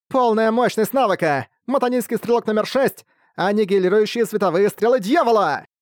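high, excited skill callout; skill shout. Acted. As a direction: announce the ultimate. Archer shouts skill name.

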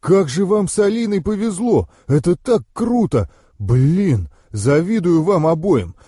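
high-pitched, enthusiastic gushing; delighted admiration. A narrator's reading. Andrey envying his gifted friends, delighted admiration. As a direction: gush with envy and delight. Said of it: "восхищался Андрей".

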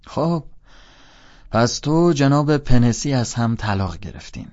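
loud, slow, playful teasing; joking. A narrator's reading. Teacher teases former pupil about parting from Pencey, joking.

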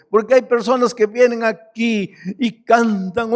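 fervent pulpit preaching; fiery preaching. Acted. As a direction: preach it out to the congregation. Preacher at full force.

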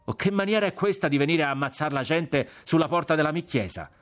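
high-pitched, scolding friar's outburst; indignant shouting. A narrator's reading. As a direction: chase troublemakers off with an outraged rant. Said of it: "gridò".

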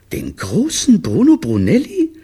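high, loud, rising disbelief; incredulous question. Acted. Mother checking whom they bit, high and incredulous. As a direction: swoop up in astonishment. Mother cannot believe the answer.

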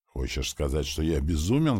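usual speaking voice

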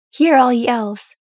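loud, slow proclamation to the whole hall; crying out. A narrator's reading. Galadriel, glowing, calls out loud and slow to all the elves. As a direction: call out slowly and loud, arms open. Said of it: "Galadriel cried".

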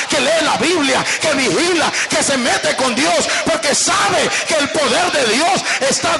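loud, fervent pulpit preaching; preaching at full force. Acted. Preacher thundering to church about Satan, fervent. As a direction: preach it out hard to the congregation.

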